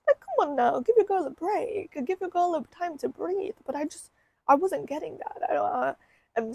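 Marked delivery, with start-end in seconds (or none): 0.1-3.6 higher and louder mock plea to God; exasperated plea. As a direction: beg for a break, half joking, pitched up. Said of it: "And I'm like".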